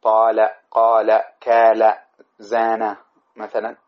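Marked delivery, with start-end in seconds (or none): 0.0-1.9 طال قال كال drawn out, long alif; teacher modelling hollow verbs slowly; slow examples. Shown as a demonstration. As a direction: stretch each example verb. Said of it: "مثلًا طال".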